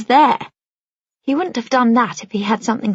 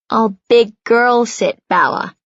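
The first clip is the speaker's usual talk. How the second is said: slow and playful; 2 semitones higher, 3 dB louder, slower than usual. A narrator's reading. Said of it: "Noah says".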